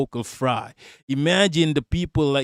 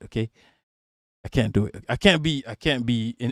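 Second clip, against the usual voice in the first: ordinary talk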